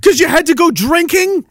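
outraged yelling. Retold or imitated. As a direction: call out the crowd for stepping over him. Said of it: "And it's like".

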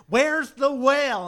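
shouted demand. Retold or imitated. Ahab cutting off the laughing captains, slow yell. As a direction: bellow it like a crazed captain.

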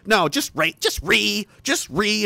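high, drawn-out mocking "ree"; stretched, jeering screech imitation. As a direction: imitate a mindless screeching protester. Mimics opponents' screeching instead of arguing.

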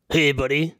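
lightly voiced pigeon character; a hint of a French-guy pigeon; talking pigeon. Pigeon confronts a man on the street, lightly voiced. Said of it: "I'm just, like, impersonating him".

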